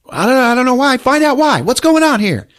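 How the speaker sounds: very high, loud panic; panicked. Retold or imitated. Charlie Day panicking as the drones fail, very high and loud. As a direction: shout in alarm as things fail.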